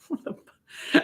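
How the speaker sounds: whispered disbelief under the breath; a voiceless gasped curse; shocked whisper. Retold or imitated. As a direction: breathe it out, stunned. Stunned reaction to stranger's request.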